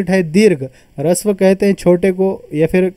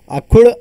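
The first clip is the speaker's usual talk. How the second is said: अखुड़ said clearly higher and louder, rising; term stressed; 4 semitones higher, 5 dB louder than usual. Shown as a demonstration. Teacher stressing the Marathi term अखुड़ for students. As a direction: hit अखुड़ high and loud. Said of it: "अखुड़ उच्चार यानी".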